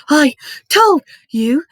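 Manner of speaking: high, loud, slow gasped words; gasping. A narrator's reading. Hermione clutching the stitch in her chest, gasping. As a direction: gasp it out one word at a time. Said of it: "Hermione gasped".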